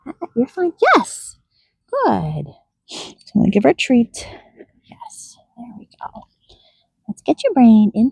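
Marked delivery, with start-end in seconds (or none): 4.8-6.3 slow hushed murmur; soothing whisper. Real, not acted. Trainer fitting gear on her donkey, slow whisper. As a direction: whisper slowly to keep her calm.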